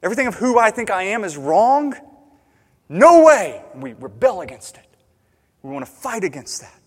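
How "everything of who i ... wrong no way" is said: rising to a loud outraged No way; protest, building to a shout; the listener's outraged objection, getting louder; indignant protest. Retold or imitated. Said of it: "we begin realizing".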